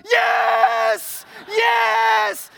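high, full-throated victory shout; long, exultant cheer; screaming celebration. Acted. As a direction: yell it as the winning putt drops. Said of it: "He's screaming and yelling".

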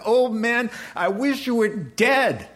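hammed-up spiteful son; mock defiant son. Prodigal son sneers at his father, mock spite. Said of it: "I look at him and said".

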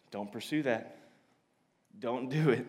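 acted low, soft plea; hushed warning. Voices God gently warning someone.

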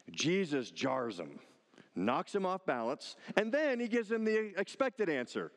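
ordinary delivery

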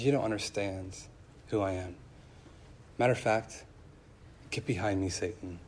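low, soft, measured reproof with pauses; quiet rebuke. Acted. Jesus rebuking Peter, quiet rebuke.

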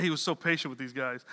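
normal talking voice

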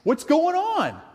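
high, pleading mock whine; impatient whine. Carrying our impatience to Jesus, a high mock whine. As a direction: whine like a kid who won't wait. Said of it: "and we're like".

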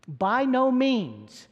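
"By no means" high, loud and drawn out, NO held long; emphatic no. Retold or imitated. Preacher repeating Paul's strongest negative answer, emphatic no. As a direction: proclaim a slow, ringing "By NO means". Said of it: "It is the emphatic no".

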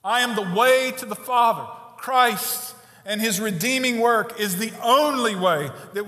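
fervent revival preaching; preaching at full force. Acted. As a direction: drive the gospel call hard to the congregation.

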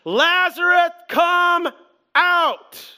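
high, loud commanding call. A narrator's reading. Jesus calling Lazarus out of the tomb, loud command. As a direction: call him out of the tomb at full voice. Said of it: "Jesus called out in a loud voice".

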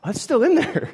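high surprised yelp; a fake-startled high squeak; mock surprise. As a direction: act shocked it's still in. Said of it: "I'm like".

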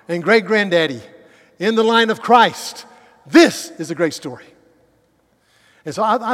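loud, soaring pulpit voice; full-force preaching. Acted. Preacher hailing King David's line to the church, loud and rousing. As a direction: build it up like a revival climax.

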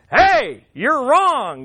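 loud, pointed public accusation; confrontational shout. Acted. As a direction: call them out loudly, stressing WRONG. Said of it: "they didn't have a shouting match".

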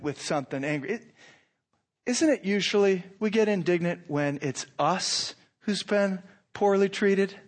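ordinary delivery

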